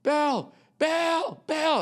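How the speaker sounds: high, insistent calls of Bill; heckler calling out. Retold or imitated. Heckler calling Bill from the crowd, high and insistent. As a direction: pester the comic over and over by name. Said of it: "This guy just goes".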